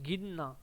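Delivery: गिनना said softly with a short गि; गिनना with the न doubled; model word. Shown as a demonstration. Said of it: "छोटी ई की मात्रा होती है". (गिनना, छोटी इ के साथ)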